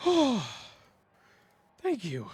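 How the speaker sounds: big relieved outburst, high falling "oh", then loud and hurried; Yorn. Acted. Game master as Yorn after the falling man survives, loudly relieved. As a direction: let the panic out in loud relief. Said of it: "Yorn's just like".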